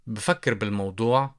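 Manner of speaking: بفكر بالموضوع said quickly at natural speed; quick run-through. Shown as a demonstration. Teacher saying بفكر بالموضوع at natural speed for learners. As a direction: rattle off بفكر بالموضوع fast like a native. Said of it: "I'll think about it".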